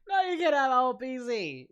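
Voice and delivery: high, loud mock-indignant refusal; playful shout. Real, not acted. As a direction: shout the refusal through laughter. Refusing viewer's cheeky request.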